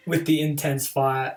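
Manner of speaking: ordinary talk, nothing marked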